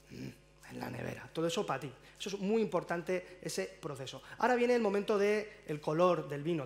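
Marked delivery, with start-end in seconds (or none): 0.1-1.3 very low, soft hum and murmur; mock pondering recollection. Comedian showing a repeated sniff of the wine, low pondering murmur. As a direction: murmur it deep, as if savouring a discovery. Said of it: "Lo repites, vuelves a oler".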